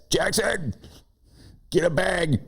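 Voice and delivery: high yelled call down the house; yell for son. Retold or imitated. Dad yelling to son to fetch a bag, high calling shout. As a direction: holler at Jackson to grab a bag. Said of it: "That's why I yell at Jax".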